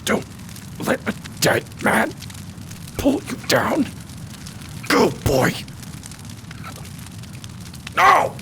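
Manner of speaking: high, strained whisper rising to a cry; dying mentor's plea. Acted. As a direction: gasp out a dying man's last order. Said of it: "he looks up and he goes".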